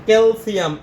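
ক্যালসিয়াম with the vowel of ক্যাল brought out; first vowel emphasized. Shown as a demonstration. Teacher showing which letter calcium takes, ক্যালসিয়াম sounded out. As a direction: lean on ক্যাল at the start of ক্যালসিয়াম. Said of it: "এ উচ্চারণ আসে".

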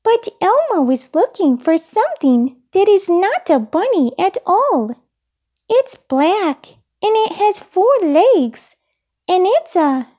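high-pitched puppet voice; very high childlike character voice; Elmo, explaining. A narrator's reading. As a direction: explain earnestly in a small high voice.

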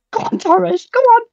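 cheering his striker as he runs through, going high; rising excited cheer. Real, not acted.

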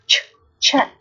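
ch- alone as "tch", then chat; ch- as a voiceless burst, then the whole word chat; isolated CH, then word. Shown as a demonstration. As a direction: sound out ch- before saying chat. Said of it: "được phát âm là âm ch".

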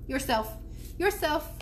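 high-pitched parroting mynah; bird echo. A narrator's reading. Mynah bird repeating the boy's retort, bird voice. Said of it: "he repeated".

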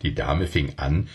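ordinary delivery

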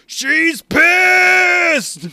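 high, very loud drawn-out shout; hammed-up full-volume yell; imitated scream. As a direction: scream it out like a panicked cartoon character. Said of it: "Polly just screams".

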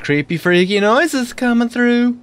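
climbing into a high, held pitch; put-on new voice. Gamer reporting strange noises, voice climbing high. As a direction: let the voice slide way up. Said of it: "new voice".

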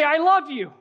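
high, loud declaration of love; excited. Acted. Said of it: "and you're, like, excited".

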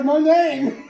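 loud, laughing shout. Acted. Acting out favorite line.

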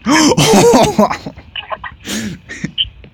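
high gasp of delight; sharp excited gasp. Real, not acted. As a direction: gasp like you just found treasure. Finds a good item.

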